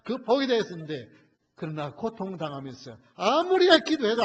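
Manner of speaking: loud, high impassioned sermon voice; full-force preaching. Acted. Preacher pleads loudly with the congregation about Job. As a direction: drive the question home to the congregation.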